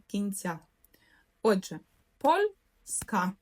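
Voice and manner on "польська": slow, split into syllables: поль-ська; по складах. Shown as a demonstration. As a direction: say польська one syllable at a time, with a gap. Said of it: "ділити слово на склади". (повільно, по складах: поль-ська)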